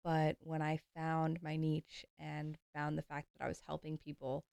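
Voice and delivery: normal talking voice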